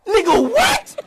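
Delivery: yelling in disbelief; sudden loud what; loud shocked shout. Real, not acted. Sudden outburst at a friend.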